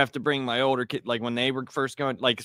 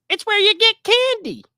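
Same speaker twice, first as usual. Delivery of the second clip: put-on much higher, louder child voice; an excited small kid explaining Halloween; little kid voice; 8 dB louder than usual. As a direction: squeak it out like a small boy. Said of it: "He goes".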